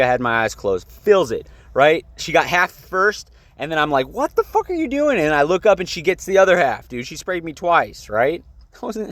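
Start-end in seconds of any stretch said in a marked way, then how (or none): 4.1-5.2 high-pitched, incredulous outcry; re-enacted startled objection; retold shocked protest. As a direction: act out disbelief at a sudden attack, pitch way up. Retells reaction to being maced.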